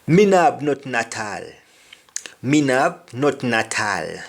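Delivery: patois Me no have nothing at all; Jamaican patois. Shown as a demonstration. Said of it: "I don't have anything at all".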